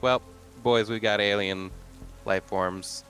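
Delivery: normal talking voice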